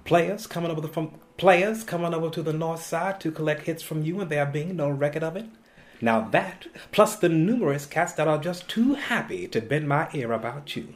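put-on righteous preacher with New York accent; Denzel-like New York accent with Southern preacher cadence; New York accent mix. Actor preaching as a pastor in the play, New York accent with Southern touch. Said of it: "that was the mix I used".

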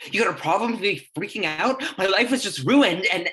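choking up while lashing out; crying outburst. Acted. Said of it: "I've just never seen you cry".

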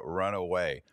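ordinary talk, nothing marked

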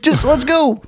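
high, slowed urgent brush-off; teen fleeing spiders, high hammed-up urgency; mock urgency. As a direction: cut them off, no time to explain. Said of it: "He's like".

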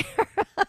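short high laugh after the jab. Real, not acted. Guest laughing to host about Bill Gates. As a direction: laugh at her own remark.

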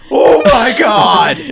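slow, high, loud cry of horror; horrified kids. Retold or imitated. Kids watching the cat swallowed, crying out. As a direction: scream it out like terrified kids. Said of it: "the kids are like".

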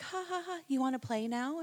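high-pitched teasing laugh then question; teasing invitation. Retold or imitated. Boy teasing his upset sister, high playful taunt. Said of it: "then he's like".

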